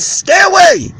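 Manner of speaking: high, loud sharp command; shouted warning. Retold or imitated. Father warning off the intruder, shouting. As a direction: shout to drive the intruder back.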